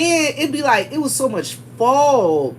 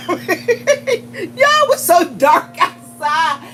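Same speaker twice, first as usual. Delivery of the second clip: laughing; 5 semitones higher, much slower than usual. Real, not acted. Laughing while telling.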